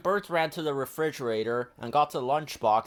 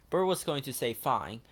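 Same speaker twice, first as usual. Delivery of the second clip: ordinary delivery, nothing marked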